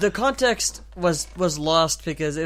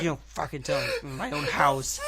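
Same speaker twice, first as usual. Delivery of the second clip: dad grumbling back; 5 semitones lower, 7 dB quieter than usual. Retold or imitated. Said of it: "He's like".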